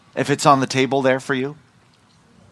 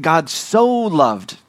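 "so" raised, louder and drawn out; emphatic "so"; 4 semitones higher, 4 dB louder, much slower than usual. Shown as a demonstration. Preacher explaining the Greek word order, "so" emphatic. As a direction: lean hard and slowly on "so loved". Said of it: "And so it's emphatic".

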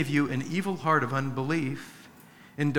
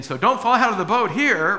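loud, higher warning shout; warning call; 6 semitones higher, 7 dB louder than usual. Retold or imitated. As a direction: call it out over the rapids. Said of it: "And they're saying".

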